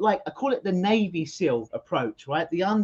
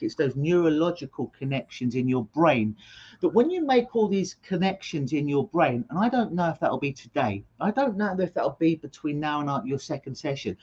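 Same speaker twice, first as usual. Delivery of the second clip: normal talking voice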